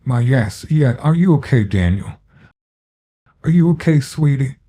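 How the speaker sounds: put-on fussing over the hurt boy; anxious woman. Shaken woman comforts hurt child.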